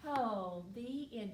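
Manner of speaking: plain reading voice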